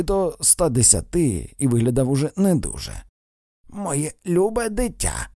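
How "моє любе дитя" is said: put-on high, slow, thin old man's voice; drawn-out reedy croak of an ancient man. A narrator's reading. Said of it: "прохрипів він тоненьким голосом".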